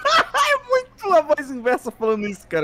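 high laughing exclamation; laughing shout. Real, not acted. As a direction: burst out laughing as you say it. Laughing at the meme.

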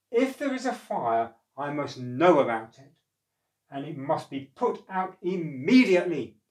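loud, commanding gnome; loudly. Acted. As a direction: bark the order to put the fire out. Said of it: "he said loudly".

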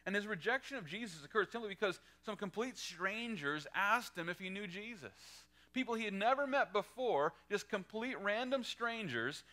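high, loud preacher's build; fervent sermon delivery; preaching at full force. Acted. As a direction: drive it home to the congregation. Preaching, rising intensity.

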